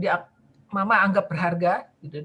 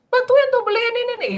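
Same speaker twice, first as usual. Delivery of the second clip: istri mengomel; 14 semitones higher, 6 dB louder than usual. Retold or imitated. Wife nagging in acted scene.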